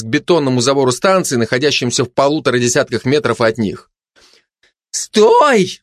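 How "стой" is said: high-pitched cry to halt; sudden shout. A narrator's reading. Gossip stops the glutton short, shouting. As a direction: cry out suddenly to stop her. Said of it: "вдруг крикнула обжоре сплетница".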